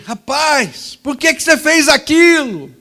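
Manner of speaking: angry neighbor. Retold or imitated. Neighbor furiously questioning the boy who flattened his tire. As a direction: bark the question at the kid.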